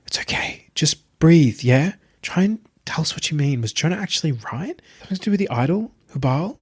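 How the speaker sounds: gentle, reassuring hush; soothing. A narrator's reading. Tabitha soothing Marius.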